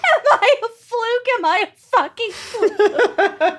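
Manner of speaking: laughing. Pretend. Repeating the bit, laughing.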